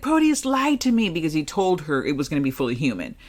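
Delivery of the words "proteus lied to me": upset mother quote. Retold or imitated. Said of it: "she's like".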